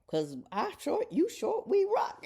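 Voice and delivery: high, rising mock cheer; playful cheering. Bantering with fellow short women in a store, playful cheering.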